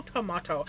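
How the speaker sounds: tomato with a broad ah vowel, falling; British tomato. Shown as a demonstration. The vlogger answers herself with to-MAH-to, falling. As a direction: say tomato as to-MAH-to and let it drop. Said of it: "Tomato. Tomato".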